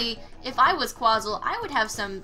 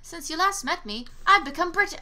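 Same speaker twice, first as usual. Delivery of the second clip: high, louder mock announcement; punchline setup; 7 semitones higher, 3 dB louder than usual. Player setting up a British joke, high announcing voice. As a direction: announce it high and grandly, then break off. Delivers planned punchline, cuts off.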